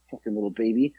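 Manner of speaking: low, soft muttered aside; muttered grudge. Retold or imitated. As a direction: mutter it under your breath, retelling. His private reaction to the baby.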